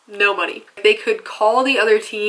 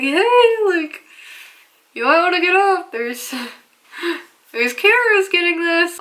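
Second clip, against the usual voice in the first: urgent nudge, high; 8 semitones higher than usual. Retold or imitated. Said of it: "I'm like".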